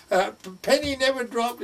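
high, spluttered admission; astonished admission. Retold or imitated. Friend admitting he never twigged, astonished. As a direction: act out the dawning realisation loudly. Friend flustered as penny drops.

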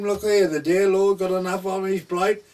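high, loud and emphatic; loud retold line. Speaking to the dying farmer about the dear Lord, loud and high. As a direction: belt out the punchline to the old man.

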